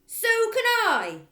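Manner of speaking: very loud high snap; snapped retort. Acted. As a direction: snap it back. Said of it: "Miss Trunchbull snapped".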